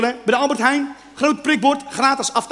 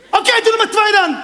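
high, loud burst of surrender; worn-down customer snapping loudly; exasperated giving in; 9 semitones higher, 5 dB louder than usual. Acted. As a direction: cave in with a frustrated yell. Gives in to insistent owner.

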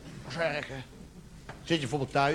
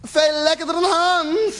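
mock ecstatic scream; 6 semitones higher than usual. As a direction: cry it out high, stretching the last word. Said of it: "En ze schreeuwt".

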